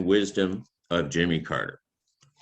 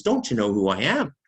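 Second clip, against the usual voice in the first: a retold huff, higher and louder; the prime minister snapping indignantly at the waiter; mock indignation; 6 semitones higher, 4 dB louder than usual. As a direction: bristle like an offended prime minister demanding respect. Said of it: "Dief gets mad".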